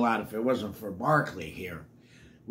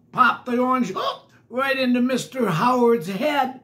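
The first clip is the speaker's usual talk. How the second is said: excited; 9 semitones higher, 6 dB louder than usual. A narrator's reading. Excited read of mishap.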